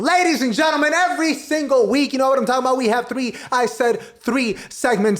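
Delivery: high, hyped-up announcer voice lifting the room; show host intro. Acted. Host announcing the segment to the guest, hyped-up.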